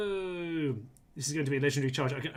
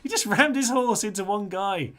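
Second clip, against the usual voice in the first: excited; 7 semitones higher, 9 dB louder than usual. Real, not acted. Marvelling at a heroic charge.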